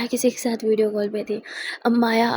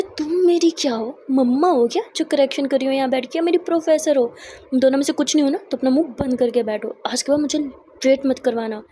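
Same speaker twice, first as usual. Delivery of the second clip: angry telling-off; 3 dB louder than usual. Acted. Charu telling Akshu to shut up, loud angry scolding. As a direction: put her firmly in her place. Charu snaps at being corrected.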